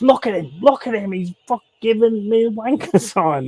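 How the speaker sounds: high-pitched mimicked tattling; outraged complaint. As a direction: imitate a customer complaining to the manager. Customer complains to manager, retold.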